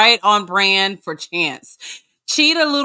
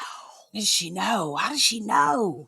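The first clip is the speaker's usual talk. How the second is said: a hushed, slow whisper of disbelief; a slow, stunned whisper; shocked whisper; 7 dB quieter, slower than usual. Retold or imitated. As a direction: whisper it like you can't believe it. Shocked whisper reenacting the reaction.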